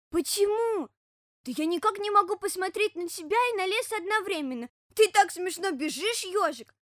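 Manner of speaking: put-on high, loud childlike bear-cub voice; excitable bear cub. Bear cub finding the running hedgehog funny, high and excited. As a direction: protest in a high little cub's voice.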